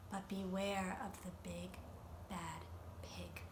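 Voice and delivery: dropping to a whisper on the pig's name; hushed warning. Acted. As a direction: warn them in a low, secret hush. Mother warns of the pig.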